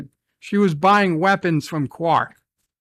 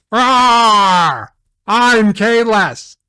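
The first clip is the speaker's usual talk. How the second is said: louder, slow warrior roar with a growl; roaring Kahless; 5 dB louder, much slower than usual. Retold or imitated. Ranting fan playing Kahless, slow roaring bellow. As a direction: bellow your own name like a Klingon hero.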